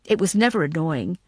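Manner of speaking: ordinary delivery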